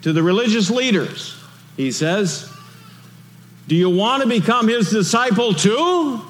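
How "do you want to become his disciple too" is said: high, sly mocking question; sarcastic. Healed man taunting the religious leaders, sarcastic. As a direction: needle them with a teasing jab. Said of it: "hint of sarcasm there".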